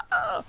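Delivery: very high-pitched squealed imitation moan; mock loud moan. Telling friends how she moaned, mock high squeal. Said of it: "like, moan, like, super loud".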